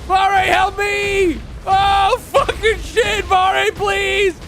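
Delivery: high desperate shouting for Vare; panicked cry for help. Real, not acted. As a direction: yell for help in real panic. Calling ally for help while attacked.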